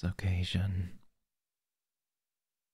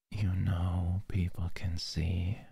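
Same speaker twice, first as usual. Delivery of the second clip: breathy close-mic whisper. Acted. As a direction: whisper it right into the ear. Whispered roleplay.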